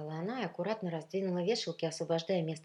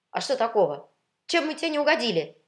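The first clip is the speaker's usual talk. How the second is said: high, loud, affronted question; raised, bewildered challenge; surprised; 7 semitones higher, 11 dB louder, faster than usual. A narrator's reading. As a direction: protest in puzzled indignation. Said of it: "удивились беленькие кеды".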